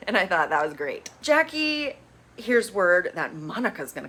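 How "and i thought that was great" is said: usual speaking voice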